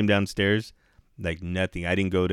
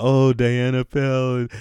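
high, loud fussing imitation; mock concern; 5 semitones higher, 4 dB louder than usual. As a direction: mimic everybody's overblown sympathy. Mimics everybody's fuss over her.

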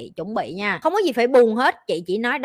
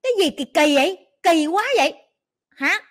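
higher, louder grossed-out scold; mock disgust; 6 semitones higher, 5 dB louder than usual. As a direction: scoff at how pathetic it is.

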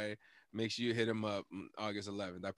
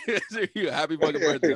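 loud, higher-pitched words through laughter; laughing; 5 semitones higher, 10 dB louder, faster than usual. Real, not acted. Podcaster wishes his friend happy birthday, laughing.

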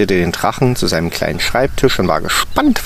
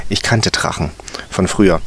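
plain reading voice

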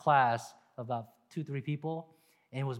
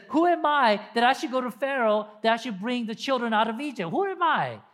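high, louder pleading as Moses; despairing Moses, higher and louder; 8 semitones higher, 6 dB louder than usual. Retold or imitated. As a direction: cry out Moses' self-doubt. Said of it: "He says".

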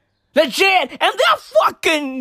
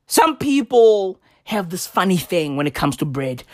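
usual speaking voice

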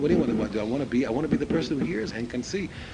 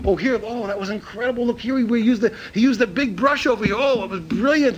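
higher-pitched, gushing art lover; kvelling; 9 semitones higher than usual. Retold or imitated. Artist looking at a picture, kvelling over the brushstroke. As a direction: marvel aloud, drawing out the praise. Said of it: "he's quelling over the brushstroke".

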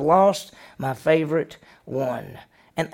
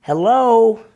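loud high call into the pit; 6 semitones higher, 8 dB louder than usual. Retold or imitated. Reuben calling into empty pit.

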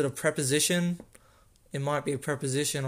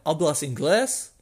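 HABLAS INGLÉS with a rising, questioning end; lift at the end; 4 dB louder than usual. Shown as a demonstration. Teacher showing how Spanish questions sound, INGLÉS lifted at the end. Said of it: "with a little lift at the end".

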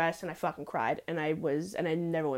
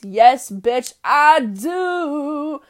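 loud, proud comeback; defiant shout; 6 semitones higher, 12 dB louder, much slower than usual. Real, not acted. She answers the critic with a loud, defiant yes.

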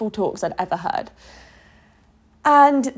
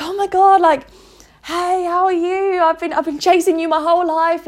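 much higher, delighted greeting of a long-lost friend; gushing high-pitched hello; excited reunion; 9 semitones higher than usual. Pretend. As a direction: squeal hello to your future self. Meeting your best self in heaven.

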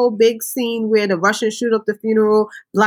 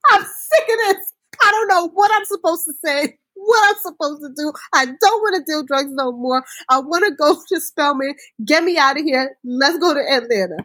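put-on high whiny teen voice, much higher; imitating Diana; 7 semitones higher than usual. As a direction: whine it like a fed-up girl. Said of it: "Diana said".

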